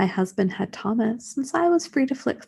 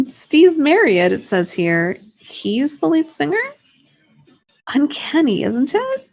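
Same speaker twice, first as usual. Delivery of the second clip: a louder, higher amazed read of the sleeve; amazed husband; 6 semitones higher, 5 dB louder than usual. Acted. Husband Mark amazed by the singer's face, loud and high. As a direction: marvel at the uncanny likeness.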